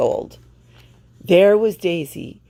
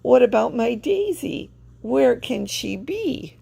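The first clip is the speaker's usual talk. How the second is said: worried; 8 semitones higher than usual. A narrator's reading. Worried hen owner.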